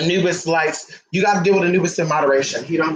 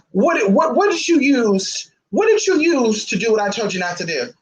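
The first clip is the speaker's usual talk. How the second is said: mock stern interrogation; 5 semitones higher, slower than usual. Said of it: "then she'll be like".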